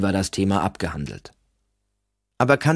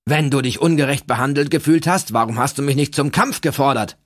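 higher, louder angry outburst; flaring up; 7 semitones higher, 4 dB louder than usual. A narrator's reading. Olaf challenging Kandamir, angry outburst. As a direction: Olaf flares up, hot and loud. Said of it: "brauste Olaf auf".

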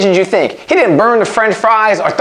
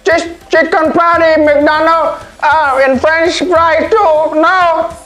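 high, protesting voice, a bit slow; complaining customer; 6 semitones higher, slower than usual. Acted. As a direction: whine that there's no beef. Acted character complaining, high voice.

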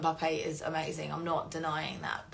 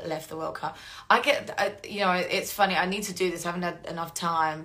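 normal talking voice

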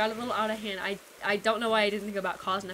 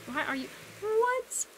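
baffled; 11 semitones higher, slower than usual. Real, not acted. Confused at friend.

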